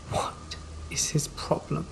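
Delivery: slow, soft whisper to herself; whispered thought. Acted. Narrator whispering her own thought about the neighbour. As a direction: breathe it out under your breath, fed up.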